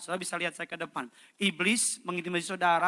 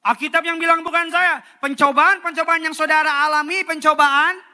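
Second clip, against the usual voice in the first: loud, high, fervent sermon; preaching at full force; 11 semitones higher, 15 dB louder than usual. Acted. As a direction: drive it home to the whole church.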